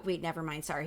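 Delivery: plain reading voice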